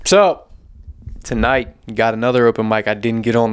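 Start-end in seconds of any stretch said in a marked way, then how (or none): none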